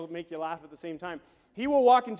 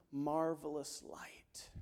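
whispered; 3 semitones lower, 8 dB quieter, much slower than usual. Real, not acted. Preacher hushes climax.

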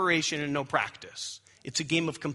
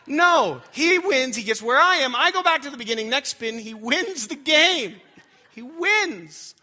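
much higher and louder, mock disbelief; hammed-up astonishment at his son winning; incredulous; 8 semitones higher, 6 dB louder than usual. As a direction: exclaim it as if you can't believe it.

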